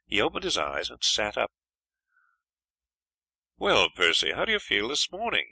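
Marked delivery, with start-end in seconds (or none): 3.6-5.5 bright greeting rising higher on the question; hearty morning greeting, louder at the start; cheerfully. A narrator's reading. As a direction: cheer up a sick friend at dawn. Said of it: "he asked cheerfully".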